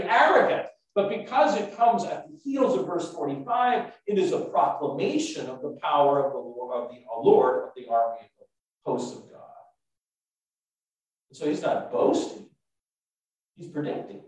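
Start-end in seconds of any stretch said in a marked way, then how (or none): none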